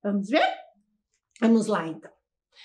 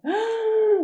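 surprised gasp; 9 semitones higher than usual. Acted. Teacher acts surprise for kids.

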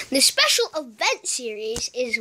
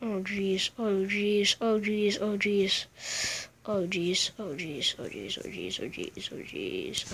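repeated, sinking lower and softer; fading dismay; 3 semitones lower, 9 dB quieter than usual. Real, not acted. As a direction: let it die away into a whisper.

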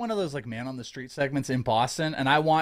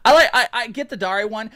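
laughing; 9 semitones higher, 8 dB louder than usual. Real, not acted. Laughing at the Boston bit.

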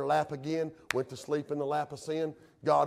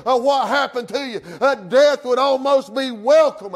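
raised, pressing pulpit cadence; full-force preaching; 8 semitones higher, 10 dB louder than usual. Real, not acted. Preacher asks backsliders who stopped caring, full-force preaching. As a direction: press the question on the congregation with force.

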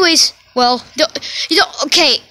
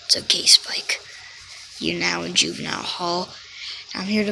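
whisper; 6 semitones lower, 7 dB quieter than usual. Acted. Whispered reassurance.

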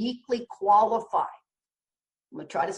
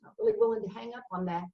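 imitating a hesitant alcoholic, voice pitched up and dropping soft; mock reluctant dodge; 5 dB quieter than usual. Alcoholics mocked for dodging spiritual help, reluctant hedging. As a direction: hem and haw, then rush the excuse faster. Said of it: "We're like".

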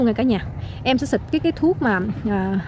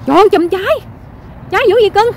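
excited exclaiming; 7 semitones higher than usual. Real, not acted. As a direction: cry out in delighted wonder. Marvelling loudly at clusters of fruit. (reo lên kinh ngạc, giọng cao)

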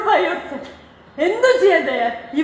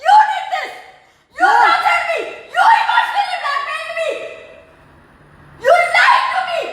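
very high-pitched, raised outburst; real angry accusation; 10 semitones higher, slower than usual. Real, not acted. Daughter accuses father of blackmail and lies, high angry outburst. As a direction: hurl each accusation in a high cry.

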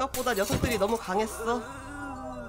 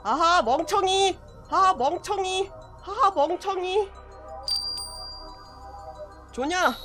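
taunting; 7 semitones higher, slower than usual. Real, not acted. Taunting enemy.

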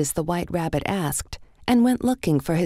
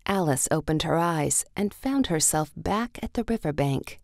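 ordinary delivery, nothing marked

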